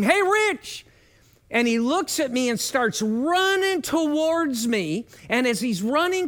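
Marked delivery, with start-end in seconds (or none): 0.0-0.9 high, loud call across a lawn; calling out to Rich across the parking lot; yelling a name. Retold or imitated. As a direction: shout to a friend running over. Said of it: "I just yelled his name".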